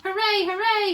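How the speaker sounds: high, loud joyful shout. Acted. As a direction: cheer it out at full voice. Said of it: "she shouted".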